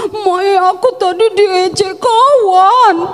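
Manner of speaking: imitated sobby tattling; crying child. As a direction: whine slowly to mama in pretend tears. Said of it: "Kita pulang nangis".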